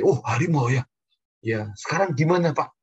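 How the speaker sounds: high, loud startled "oh, a tiger"; mock surprise. Preacher retelling his reply to the tiger owner, mock surprise.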